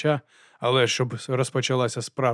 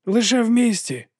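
much higher, loud shout over rushing air; shouting over wind; 11 semitones higher, 5 dB louder than usual. A narrator's reading. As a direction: yell it over the whistling wind. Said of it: "гукнув, перекрикуючи свист струменів повітря". (кричить, перекрикуючи свист вітру)

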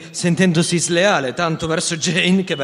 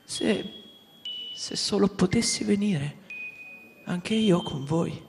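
Wendy at the window sighs her wish to go along; sighing; 5 semitones higher, 4 dB quieter than usual. Acted. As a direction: sigh the words with longing. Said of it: "Wendy sospirò".

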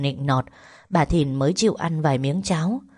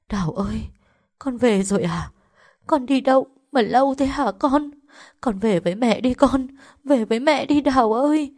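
put-on higher, tearful old mother's voice; sobbing mother; 7 semitones higher than usual. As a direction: cry out for her daughter through sobs. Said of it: "Hai mẹ con ôm nhau khóc tức tưởi".

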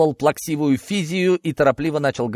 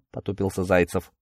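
usual speaking voice

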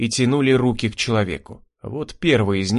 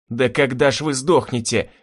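plain reading voice

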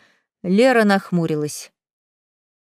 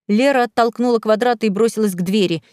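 plain reading voice

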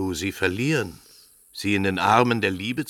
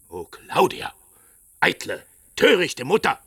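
angry scolding; 7 semitones higher, 5 dB louder than usual. Acted. Father rebuking his wife for her vanity, angry and loud. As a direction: scold her, higher and louder.

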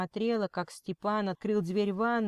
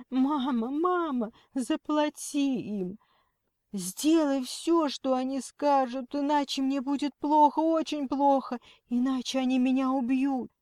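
high, nasal crying plea; tearful whine; 7 semitones higher, 4 dB louder than usual. A narrator's reading. Kidnapped Lena begs her mother to pay, tearful. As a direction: plead in a high, weepy daughter's voice. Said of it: "прозвучал гнусавый, плачущий голос".

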